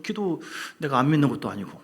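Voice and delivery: usual speaking voice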